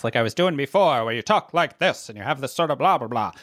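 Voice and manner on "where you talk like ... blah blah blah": put-on a light demo of an old-time radio announcer; lightly put-on old radio announcer tone. Shown as a demonstration. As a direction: slip briefly into a vintage broadcast voice. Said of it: "Part of that radio voice".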